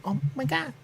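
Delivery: high, startled "oh my God"; mock-shocked exclamation; shocked gasp. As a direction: squeak it out in disbelief. Reacting in shock to game.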